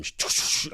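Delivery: repeated voiceless shushing bursts; chuffing sh-sh-sh of a train; train noise. Retold or imitated. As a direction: imitate a train rushing in. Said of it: "like a train".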